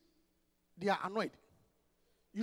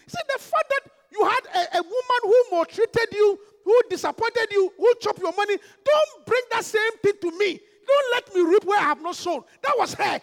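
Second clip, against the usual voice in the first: loud impassioned preaching, high and straining; preaching at full force; 7 semitones higher, 9 dB louder than usual. Real, not acted. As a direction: preach it with fire.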